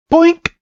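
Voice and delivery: high, springy boink; sudden bouncy sound effect; imitated pop. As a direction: make a cartoon popping noise. Imitates baby popping out.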